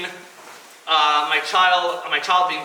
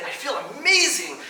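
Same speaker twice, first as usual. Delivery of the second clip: elated athlete; 7 semitones higher than usual. Pretend. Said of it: "he's gonna say".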